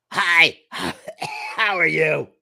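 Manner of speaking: high, loud put-on greeting; strange character voice. Second villager Jim greeting the team, high strange voice. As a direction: pitch it up high and loud, comically odd. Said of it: "talking in strange voices".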